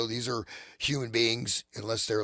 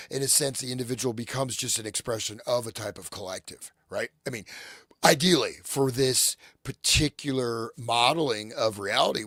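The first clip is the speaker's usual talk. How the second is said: usual speaking voice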